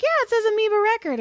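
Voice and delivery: high, quick and carefree; breezy. Retold or imitated. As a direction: brush it off brightly. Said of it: "she's like".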